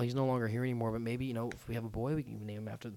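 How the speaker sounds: soft, tender, trailing-off wooing; feigned earnest suitor. Acted. Laying it on thick to Camila, feigned tenderness. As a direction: murmur it as if planning a future. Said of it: "I'm like".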